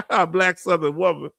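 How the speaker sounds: ordinary talk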